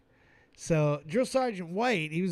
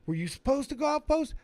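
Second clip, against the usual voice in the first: higher, insistent grilling of the recruit; drill sergeant interrogating; 9 semitones higher, faster than usual. Retold or imitated.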